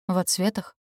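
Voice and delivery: plain reading voice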